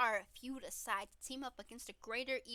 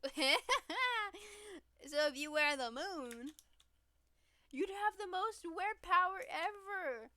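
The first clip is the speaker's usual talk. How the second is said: laughing; 8 semitones higher, 5 dB louder, much slower than usual. Real, not acted. Giddy pitch about being the moon.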